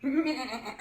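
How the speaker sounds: slow, stretched bleating "meh"; sheep bleat. Acted.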